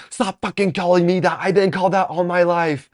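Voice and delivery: high, loud, indignant shout; all-caps yelling. Retold or imitated. Eighth grader telling off Aaron by text, all-caps yelling. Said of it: "I, like, typed in all caps".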